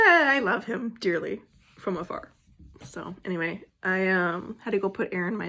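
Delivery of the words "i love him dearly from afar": giggling through the words; high giggle breaking into the line; laughing. Real, not acted. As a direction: laugh it off, a little bashful. Laughing about loving from afar.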